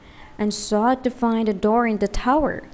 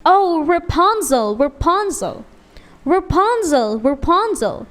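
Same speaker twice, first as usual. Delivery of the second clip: prince calling; 6 semitones higher than usual. A narrator's reading. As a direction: call up to her like the young prince. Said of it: "he cried".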